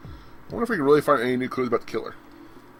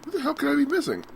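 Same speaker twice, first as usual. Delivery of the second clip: exasperated. Real, not acted. Frustrated at the game.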